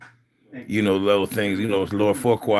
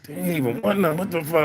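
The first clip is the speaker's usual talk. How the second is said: put-on grumbling like a kid; 7 semitones higher than usual. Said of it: "Like a little kid".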